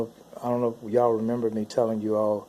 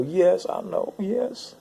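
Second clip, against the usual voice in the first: high whimpered admission, climbing higher; crying; 8 semitones higher, much slower than usual. Retold or imitated. Adam admits to God he knew, imitated high whimper. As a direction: imitate tearful whimpering, pitched up. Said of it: "He was crying and whimpering".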